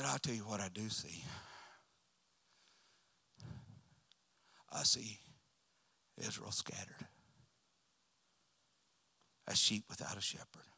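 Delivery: low, soft, grave pronouncement; quiet prophet's answer; hushed prophecy. Acted. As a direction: let the vision fall quietly, with long pauses. Prophet's grave vision retold.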